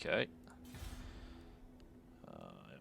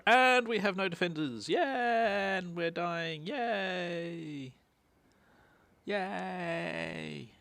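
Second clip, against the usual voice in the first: mock cheer, higher, slowing down; sarcastic cheering; 8 semitones higher, much slower than usual. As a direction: cheer sarcastically about doom. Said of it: "you go".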